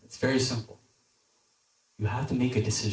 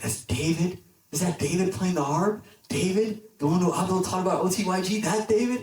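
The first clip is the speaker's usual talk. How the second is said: starstruck, higher, rising questions; excited disbelief; 4 semitones higher than usual. Acted. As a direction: spot your hero and can't believe it. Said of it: "He's, like, so excited".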